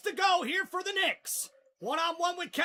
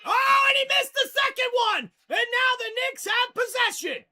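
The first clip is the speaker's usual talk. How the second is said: loud, higher burst of joy; excited shout; 4 semitones higher, 4 dB louder than usual. Real, not acted. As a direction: call the turnover with a yell. Missed free throw, Knicks ball.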